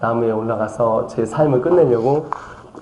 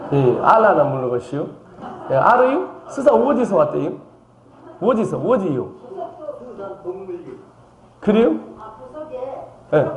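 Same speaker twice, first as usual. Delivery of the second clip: Chungcheong dialect; 6 semitones higher than usual. Retold or imitated. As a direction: talk like a Seosan villager. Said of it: "충청남도 서산시 부석면 지살리에서 왔습니다". (높은 톤의 충청도 사투리)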